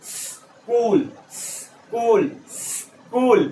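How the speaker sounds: "स्कूल" with a long hiss before "kool", very slow; long breathed-out s. Shown as a demonstration. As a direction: practise "स्कूल" slowly, stretching the opening s. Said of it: "कुछ आपको ऐसे बोलना है". ("स्कूल" में लंबा स, फिर कूल)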